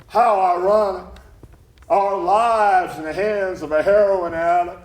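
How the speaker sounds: grand, projected irony; ironic declaiming. Acted. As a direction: declaim it over the din. Companion declaims over the mayhem.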